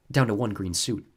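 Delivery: usual speaking voice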